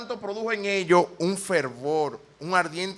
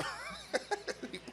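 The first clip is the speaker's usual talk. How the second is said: whispered laugh; 6 semitones higher, 14 dB quieter than usual. Real, not acted.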